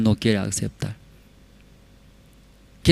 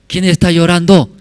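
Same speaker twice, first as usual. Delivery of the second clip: acted startled question, raised and loud; mock-startled, higher-pitched question; startled demand; 7 semitones higher, 6 dB louder than usual. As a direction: demand to know, as in a retold phone call. Acts secretary demanding who cries.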